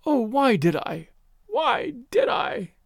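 slow high anguished moan; moaning in shame and regret. A narrator's reading. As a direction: draw out the lament slowly. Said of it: "she was moaning".

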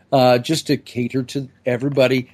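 ordinary delivery

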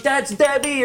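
very high-pitched mock holler; hyped friend. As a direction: imitate a hyped buddy crowing in recognition. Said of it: "everybody was so hyped".

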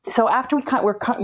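ordinary talk

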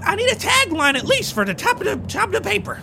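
high, pushy reporter; frantic reporter voice. Acted. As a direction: pester them like a desperate journalist. Desperate reporter NPC.

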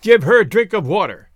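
loud, emphatic order; forceful relayed command; passed-on command. A narrator's reading. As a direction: bark the order along to someone else. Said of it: "Mapui passed on the command".

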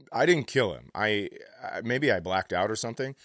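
ordinary talk

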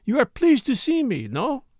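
a Frenchwoman's light murmur of his name. A narrator's reading. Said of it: "Morel teasing Derek".

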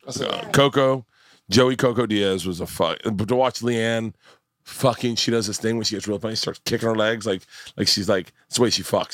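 ordinary delivery

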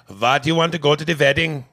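mock Indian-accented Siri questioning the caller; put-on Indian accent, hammed up. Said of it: "have an Indian male accent".

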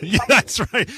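high, loud laugh into words. Real, not acted. Host laughs at slip.

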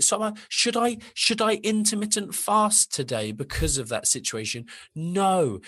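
high, slow, pleading exasperation; exasperated rant. Retold or imitated. Coach pleading with dieting clients to stop, high exasperated. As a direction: plead with them to just stop, pitched high.

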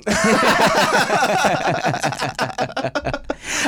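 cracking up for real. Real, not acted. Co-host laughing at being told they love each other.

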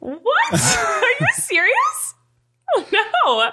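high, slow, giggling shock; laughing disbelief. Real, not acted. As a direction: gasp it out through laughter. Realizes she is wrong.

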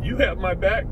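ordinary delivery

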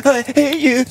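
high tearful outburst, hammed up; mock crying. Child to parent, pretend bawling. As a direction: blurt it through fake sobs. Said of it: "Just stop crying".

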